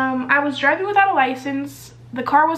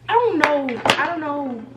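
ordinary talk

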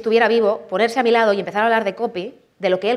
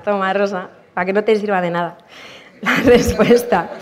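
laughing mock taunt; 4 dB louder than usual. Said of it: "en plan".

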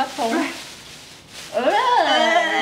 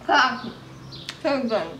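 cheeks stuffed full; 3 semitones lower, 3 dB quieter than usual. Real, not acted. Four marshmallows stuffed in.

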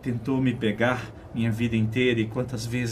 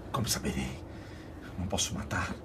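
whispering; 4 semitones lower, 7 dB quieter, faster than usual. Acted. Anguished inner struggle.

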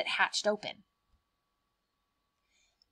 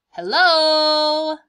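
higher, louder calling hello; loud playful hello; 8 semitones higher, 13 dB louder than usual. Acted. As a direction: call out over your shoulder. Mantis turning head to look.

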